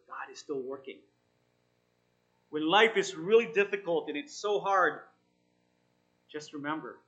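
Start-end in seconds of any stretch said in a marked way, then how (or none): 2.5-5.0 louder, urging preacher's voice; emphatic preaching. Acted. Pastor urging the struggling listeners, louder and emphatic. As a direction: press the promise on them.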